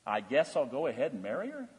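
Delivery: incredulous echo. Acted. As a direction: echo his words back as if they can't be serious. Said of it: "How many of you ladies wanna marry".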